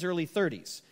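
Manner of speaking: ordinary talk, nothing marked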